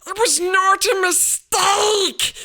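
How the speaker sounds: high, loud, slow angry shout. A narrator's reading. Boy angrily shouting back at his uncle. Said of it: "said Harry angrily".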